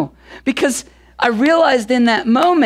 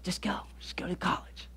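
hushed, breathy whisper of a gentle inner voice; barely voiced, like God nudging softly; 4 semitones lower, 21 dB quieter than usual. Pastor retelling God's nudge to go, whispered.

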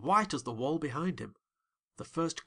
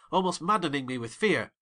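ordinary delivery, nothing marked

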